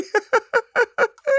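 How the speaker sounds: high-pitched, helpless giggling; real laughter. Real, not acted. Comedian cracking up at his own fart podcast, high laughter. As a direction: burst out laughing, high and loud.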